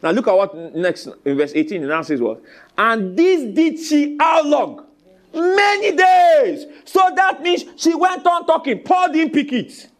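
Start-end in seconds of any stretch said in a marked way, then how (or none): 2.8-9.9 high, loud, slow preaching; preaching at full force. Acted. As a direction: build it up, drawn out. Preacher building to a climax.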